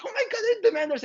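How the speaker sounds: very high panicked squeal; hammed-up panic on the ride; mock freaking out. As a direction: mom panicking on the ride, imitated shriek. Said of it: "my mom's freaking out".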